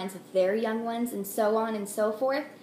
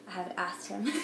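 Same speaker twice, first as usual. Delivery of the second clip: laughing. Real, not acted. Joke about husband.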